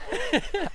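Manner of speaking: burst of laughter. Real, not acted. Laughing at own joke.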